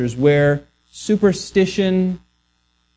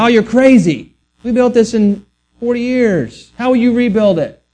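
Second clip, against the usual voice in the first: higher-pitched mock disbelief; incredulous scoffing; 6 semitones higher, 3 dB louder than usual. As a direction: act out a crowd scoffing at an absurd claim. Acting out scoffing reply to Jesus.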